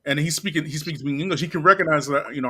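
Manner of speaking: ordinary talk, nothing marked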